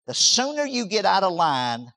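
normal talking voice

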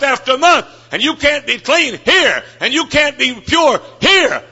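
fiery revival preaching; preaching at full force. Acted. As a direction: drive it home from the pulpit.

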